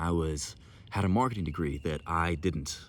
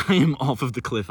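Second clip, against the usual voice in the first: laughing loudly through the line; 7 semitones higher, 10 dB louder than usual. Real, not acted. Laughing loudly while recounting leaping off the cliff. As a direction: laugh it out, thrilled.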